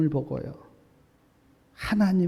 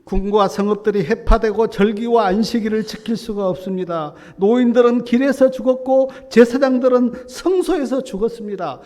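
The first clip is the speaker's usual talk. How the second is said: anguished lament; 6 semitones higher, 10 dB louder than usual. Acted. Jeremiah's lament voiced in sermon.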